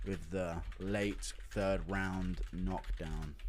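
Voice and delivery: slow, soft announcer send-off; dramatic tribute. Real, not acted. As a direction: lay it on like a tribute.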